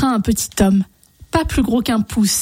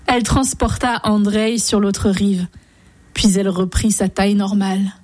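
ordinary delivery, nothing marked

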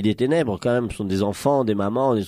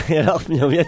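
ordinary delivery, nothing marked

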